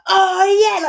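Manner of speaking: loud, high whooping cheer; a party group celebrating a free bill; mock cheer. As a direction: whoop like a winning table. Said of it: "they're all like".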